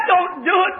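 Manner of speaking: pleading cry. Retold or imitated. Pinned boy begs, rising.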